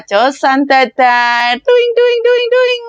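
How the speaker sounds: very high bouncy sing-song; cheerful self-pep. Shown as a demonstration. Maid cheering herself up in the morning, very high and bouncy. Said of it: "untuk menyemangati diri sendiri".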